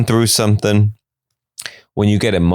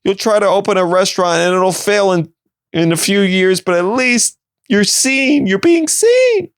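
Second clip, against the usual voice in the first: mock gushing viewer; 10 semitones higher than usual. Viewer teases Jared about fame, high mock-sweet voice. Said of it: "I was like".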